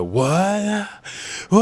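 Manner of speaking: high, drawn-out cartoon bawl; long high-pitched pretend wail; mock crying wail. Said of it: "this boy is crying".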